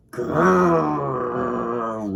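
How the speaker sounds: long, rolling, loud growl; drawn-out bear growl; bear roar. A narrator's reading. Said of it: "roared the big brown bear".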